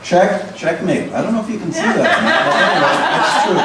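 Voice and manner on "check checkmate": normal talking voice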